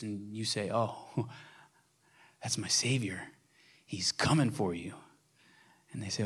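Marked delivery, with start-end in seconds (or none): 0.7-4.9 softer, half-whispered with a small laugh; softly voiced friend sharing a secret; hushed pretend reply. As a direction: lean in and tell them gently.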